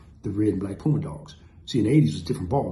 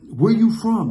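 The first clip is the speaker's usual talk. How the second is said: loud challenge; 9 semitones higher, 8 dB louder, slower than usual. Retold or imitated. Gang challenge from the lead.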